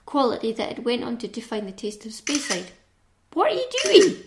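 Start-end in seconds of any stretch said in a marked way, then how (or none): 3.3-4.2 startled outburst. Real, not acted. Annoyed at noise mid-reading.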